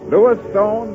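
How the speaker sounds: ordinary delivery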